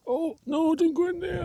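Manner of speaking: alarmed cry. Real, not acted. Camper watching a sausage roll into the fire, high alarm. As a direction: cry out as the sausage rolls away.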